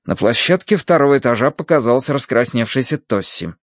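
plain reading voice

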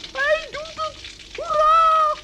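high, loud hooray; the tap finally runs, high loud cheer. Acted. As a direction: burst out with joy.

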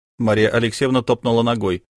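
plain reading voice

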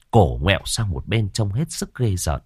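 ordinary delivery, nothing marked

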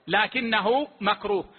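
ordinary talk

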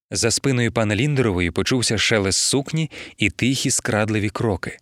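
plain reading voice

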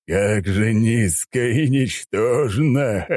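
villainous mocking laugh; scornful laughter. A narrator's reading. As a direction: gloat grandly, then cackle. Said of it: "снова расхохотался".

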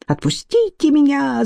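high-pitched cry without real volume; thin, high cry. A narrator's reading. Scientist cries out though nobody holds him, squeaky protest. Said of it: "закричал он".

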